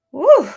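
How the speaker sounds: loud high whoop of joy; excited whoop. Real, not acted. Excited cheer over deals.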